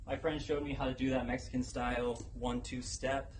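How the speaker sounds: ordinary talk, nothing marked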